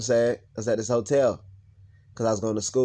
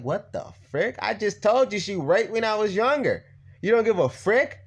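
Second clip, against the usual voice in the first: high, incredulous, indignant outburst; outraged; 8 semitones higher than usual. Retold or imitated. Speaker to officer who ignored the abuse, outraged. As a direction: pitch jumps up in disbelief.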